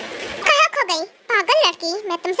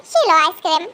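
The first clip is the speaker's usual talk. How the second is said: ordinary talk, nothing marked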